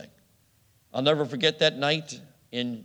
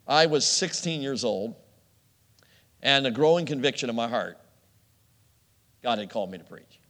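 ordinary delivery

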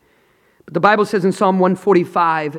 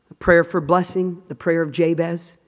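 normal talking voice

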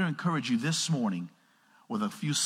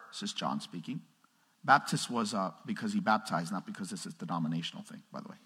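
much softer, lower aside; quiet aside; 5 semitones lower, 6 dB quieter than usual. Real, not acted. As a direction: drop out of the preaching to explain.

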